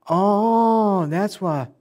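high, stretched "oh" that falls away; dawning realization. Retold or imitated. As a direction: act out suddenly getting it. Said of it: "comes in a realization of".

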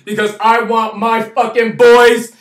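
ordinary talk

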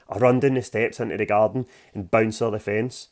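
ordinary delivery

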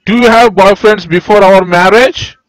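a loud jealous accusation; accusing yell. Acted. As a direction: bark the question like a suspicious husband. Jealous husband accuses wife.

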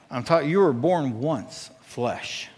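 ordinary delivery

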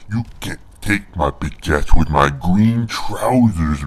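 usual speaking voice

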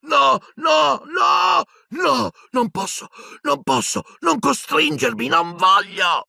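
very high, anguished screams of no; an old wizard screams in torment; screaming in terror. A narrator's reading. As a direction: scream and beg like a tortured old man. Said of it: "Silente urlò".

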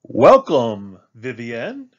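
hearty call, first word punched loud; called-out welcome. A narrator's reading. Teacher Mister J greeting Vivienne in class, calling out. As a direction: call out a welcome across the room. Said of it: "called Mister J".

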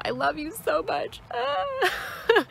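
overwhelmed, tears breaking through; tearful love. Real, not acted. Tearful outpouring of love to viewers.

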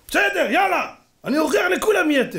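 high-pitched mock defiance; heated challenge. Father promising to expose the rabbi, high and defiant.

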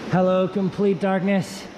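ordinary delivery

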